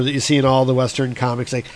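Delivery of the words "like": usual speaking voice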